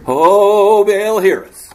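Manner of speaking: loud, high wailing plea to Baal; revival cry. Acted. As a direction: lead the crowd in the cry. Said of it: "This goes on for hours".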